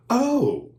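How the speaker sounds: high gasped oh; startled high oh; mock gasp. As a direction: gasp like she's shocked.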